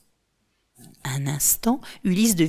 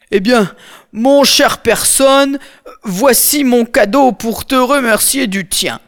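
amiable giant; 5 semitones higher, 7 dB louder than usual. Acted. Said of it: "dit aimablement Polyphème".

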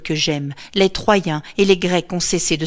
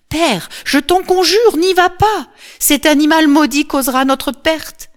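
loud, much higher pleading; imploring cry; 9 semitones higher, 5 dB louder than usual. A narrator's reading. Cassandra begs father Priam not to go, crying out. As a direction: beg your father, crying out. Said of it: "continue de crier, d'implorer".

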